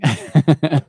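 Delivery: a loud, high burst of laughter; loud real laugh. Real, not acted. Sister laughing, he joins.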